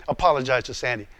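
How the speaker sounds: low, unmoved command; acted voice of God, firm and low; God's voice. God's unmoved reply in acted argument.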